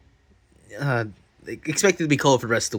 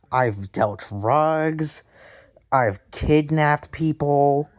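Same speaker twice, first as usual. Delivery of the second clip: very slow, weighty confession; slow dramatic; much slower than usual. Acted. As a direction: drag each word out like a boast.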